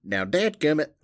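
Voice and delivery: high, loud Southern exasperation; mock-cross Southern twang; Southern accent. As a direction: curse mildly in hammed-up Southern. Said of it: "I like how the accent".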